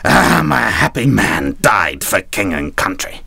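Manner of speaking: slow, drawn-out mock dying declaration; milk the heroic last words, slowly; hammed-up dying sailor; wounded hero. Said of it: "he'd clutch his latest wound, and he'd go".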